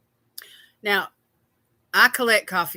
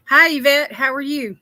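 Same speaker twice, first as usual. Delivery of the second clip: louder, higher call-out hello; bright greeting; 4 semitones higher, 7 dB louder than usual. Real, not acted. Host loudly greeting a viewer who just joined the stream. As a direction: call the name out gladly.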